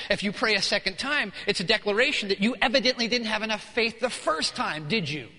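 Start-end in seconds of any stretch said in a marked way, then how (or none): none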